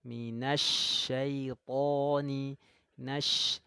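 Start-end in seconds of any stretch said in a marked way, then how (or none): 0.1-2.6 minasyaitoni slow, ta with a raised tongue back; heavy to. Shown as a demonstration. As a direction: thicken the to in syaitoni. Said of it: "Angkat pangkal lidahnya".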